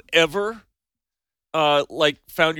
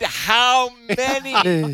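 loud, high and drawn out; louder for foreigners; 9 semitones higher, 6 dB louder, much slower than usual. Pretend. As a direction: shout it slowly as if they don't understand English. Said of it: "Get louder".

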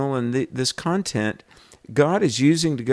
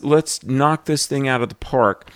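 ordinary delivery

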